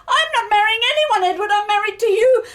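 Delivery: high, loud, quick insistence; emphatic protest. Acted. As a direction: protest to the sick husband, exasperated. Bride protests to sick husband.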